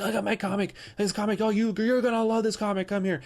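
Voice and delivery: high, mocking salesman pitch; mock pushy seller. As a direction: ham up a desperate booth seller.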